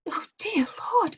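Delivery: breathless, tearful whisper of relief; relieved whisper. Acted. Woman realises Death wants Harvey, whispering with relief. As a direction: sigh the words out unvoiced.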